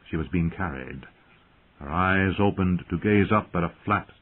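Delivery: ordinary delivery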